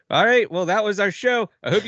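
loud, high host sign-off; show host signing off. Retold or imitated. Imitating the host closing the awards show, loud and high. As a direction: project a big cheery wrap-up, cut short. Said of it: "and he's like".

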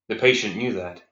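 usual speaking voice

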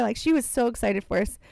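normal talking voice

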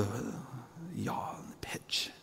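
slow breathless whisper. Acted. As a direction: whisper it like you're inside the storm. Picturing the storm-tossed boat.

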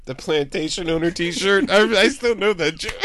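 giggling through the words; laughing. Real, not acted. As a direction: talk while still cracking up. Cracking up over old joke.

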